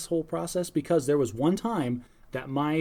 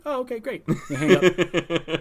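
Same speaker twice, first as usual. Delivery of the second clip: much higher chuckling mock customer; laughing through it; 3 semitones higher, 5 dB louder, slower than usual. Help desk worker mimicking callers, laughing. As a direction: laugh while voicing the happy caller. Imitating satisfied users, laughing.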